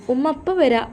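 high call from the next room. A narrator's reading. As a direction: shout back to mother that she's coming. Daughter calls from other room. (ഉയർന്ന ശബ്ദത്തിൽ വിളിച്ചു പറയൽ)

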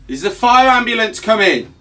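loud, high yelled question; shouting. Real, not acted. Trapped caller asking if rescue is coming, shouting. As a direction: yell it through a wall.

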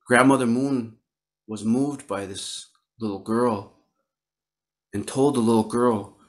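ordinary delivery

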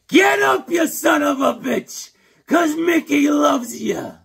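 very high, loud, slow roar; Mickey's rousing yell. Retold or imitated. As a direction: scream him back onto his feet. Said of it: "We'll do a little Mickey".

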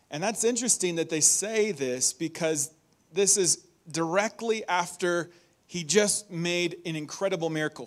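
usual speaking voice

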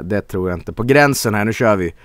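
usual speaking voice

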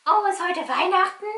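eager quick guessing; excited puppet. Pretend.

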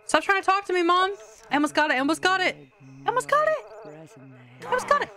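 rising frustration, nearly shouting; frustrated yelling. Real, not acted. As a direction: push through it, almost there. Frustrated gamer yelling at game.

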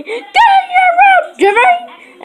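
hammed-up furious parent shout; parent yelling. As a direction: scold the kid to his room. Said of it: "my parents say".

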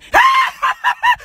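high shrieking laugh. Real, not acted. Laughing at a Valentine request, shrieking cackle.

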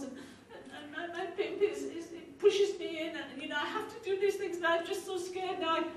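high-pitched, pleading, overwhelmed; imitating a crying woman at the end of her rope; tearful retelling. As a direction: plead as if crying, words tumbling out. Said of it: "And she's crying".

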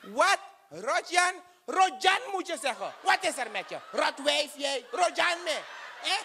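high, indignant shouting at the clerk; offended yelling. Acted. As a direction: bristle at the mangled name, yelling high. Offended passenger yells at clerk. (hoog en verontwaardigd schreeuwen tegen de baliemedewerkster)

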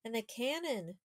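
plain reading voice

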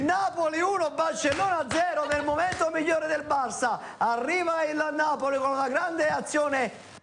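high, excited goal recap; breathless italian goal commentary. Real, not acted. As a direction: keep the thrill running through the recap.

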